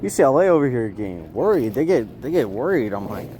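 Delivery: ordinary talk, nothing marked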